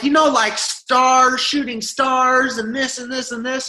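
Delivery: high, slow taunting sing-song; mocking commenters. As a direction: mimic the jeering viewers. Commenters mocking them, retold.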